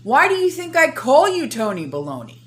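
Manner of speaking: loud, high, heated tirade; angry rant. Real, not acted. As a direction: tear into him, furious. Ranting at the official.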